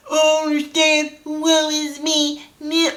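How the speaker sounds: high, drawn-out mock wail; hammed-up woe-is-me lament; mock a self-pitying whiner; playing the victim. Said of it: "They do this victim thing".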